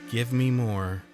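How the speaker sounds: normal talking voice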